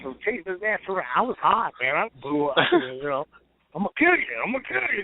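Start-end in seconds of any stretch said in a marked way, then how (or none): 3.7-5.0 retold angry threat. Acts out chasing his opponent.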